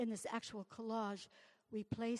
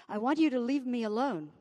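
defiant; 8 dB louder than usual. Acted. Defiant poem line.

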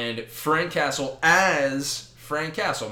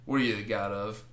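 soft, low mock tough guy; low, dismissive taunt; 4 semitones lower, 8 dB quieter than usual. Frank Castle taunting a god, low and unimpressed. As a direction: needle him quietly, barely bothering.

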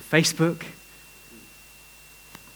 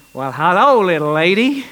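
a hint of an American old gentleman; American accent; 2 semitones higher, 6 dB louder than usual. Old man greeting the little girl, light American accent. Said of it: "I can't do an American accent, but I can do".

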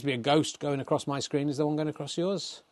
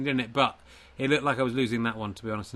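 ordinary delivery

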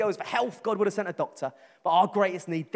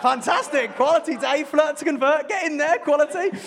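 higher, louder, hyped-up cheer of a mate; excited cheering; 9 semitones higher, 7 dB louder than usual. Retold or imitated. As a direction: egg him on with a big grin. Cheering Dave on about the girl.

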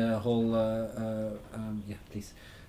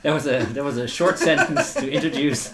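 chuckling through the words; amused, half laughing; 4 semitones higher, 6 dB louder than usual. Real, not acted. Joking about a long question.

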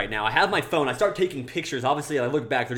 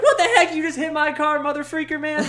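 hammed-up high-pitched tirade, laughter creeping in; outraged mock yell; 6 dB louder than usual. Lady whose car got hit, mock outraged shriek. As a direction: imitate a furious woman, high and loud. Retold lady yelling at driver.

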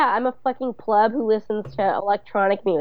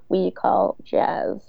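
slow voiceover drawl; much slower than usual. Retold or imitated. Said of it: "Carrie's voiceover says".

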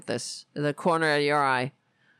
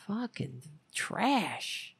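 disgusted; 2 semitones lower, 7 dB quieter, much slower than usual. Real, not acted. Disgusted slow mutter.

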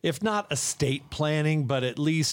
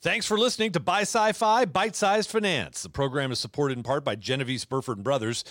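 radio outro announcer; 6 semitones higher than usual. Acted. Show outro over music.

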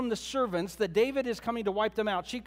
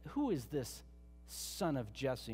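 slow, scornful, lower and softer sneer; 6 semitones lower, 9 dB quieter, slower than usual. Retold or imitated. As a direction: look down on him as you ask. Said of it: "with a big sneer on his face".

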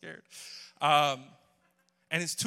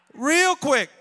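high, very loud punchline; loud exclamation; 13 semitones higher, 17 dB louder than usual. Real, not acted. Preacher marvels at the friend's switch, loud exclamation. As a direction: shout it out high and hard.

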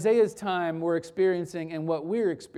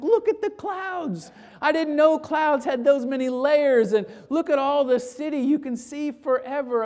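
put-on higher, awed boy looking out the window; wondering teen; 5 semitones higher than usual. Teen on his first flight gazing out, full of wonder. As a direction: marvel at every cloud.

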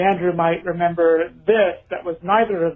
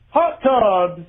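retail ad announcer; 8 semitones higher than usual. Retold or imitated. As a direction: announce the deals while giggling. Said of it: "went something a little like this".

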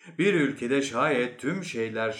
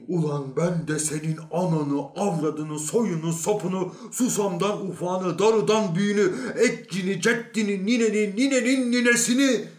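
raised curses climbing to a high shout; building cursing tirade; 8 semitones higher, 5 dB louder than usual. Acted. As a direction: pile up insults, louder and higher to the end. Man launches into cascade of curses.

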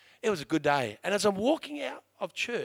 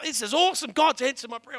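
higher, louder thrilled outburst; excited; 7 semitones higher, 10 dB louder than usual. Retold or imitated. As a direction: burst out, thrilled at answered prayer. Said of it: "I was so excited".

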